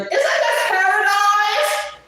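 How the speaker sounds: high, drawn-out imitation scream; mock-ecstatic full-volume holler. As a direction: yell it long and loud in someone's ear. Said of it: "if you don't stop screaming".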